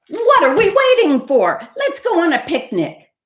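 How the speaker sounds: high, loud eager call. A narrator's reading. Raccoon suddenly keen on the picnic, high and eager. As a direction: burst out ready to go.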